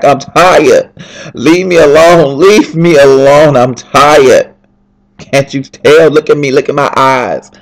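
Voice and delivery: high, loud, exasperated pleading to be left alone; tired outburst. Retold or imitated. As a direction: cry out at a friend that you're worn out and want space. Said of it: "I was like".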